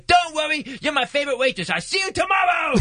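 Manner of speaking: exaggerated impaired speech, very high; high, strained, gleefully hammed-up farewell shout; Mike. Friend sending off the shaken waitress, exaggerated impaired voice. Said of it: "he cranks the level of reach on up three thousand percent".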